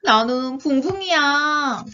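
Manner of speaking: high, slow, drawn-out little creature voice; toy character. Acted. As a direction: announce yourself like a storybook toy. Toy reveals itself in story.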